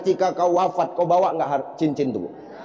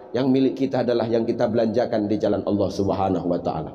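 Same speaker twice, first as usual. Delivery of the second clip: ordinary talk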